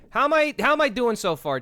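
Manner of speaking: high, loud eager question to chat; excited. Real, not acted. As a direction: ask it all pumped up.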